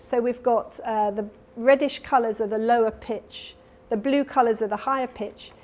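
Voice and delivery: usual speaking voice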